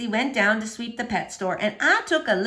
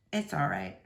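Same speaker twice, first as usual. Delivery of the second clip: low soft Otis; 9 semitones lower, 9 dB quieter than usual. A narrator's reading. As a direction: lower and softer, a lonely gentle man. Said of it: "he said".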